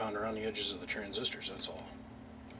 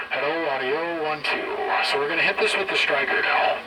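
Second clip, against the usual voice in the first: muffled; 3 semitones higher, 9 dB louder than usual. Real, not acted. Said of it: "it sounds like muffled".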